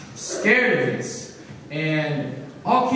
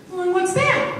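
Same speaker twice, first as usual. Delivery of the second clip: curious granddaughter; 13 semitones higher than usual. Pretend. Granddaughter poking grandpa's belly, high childlike voice. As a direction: drag it out high and innocent.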